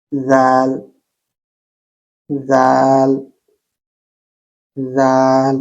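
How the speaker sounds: জাল with the tongue between the teeth each time; soft dhal. Shown as a demonstration. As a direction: repeat জাল slowly with a soft th. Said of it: "দেখেন এখানে আমি বলছি". (নরম জাল বারবার)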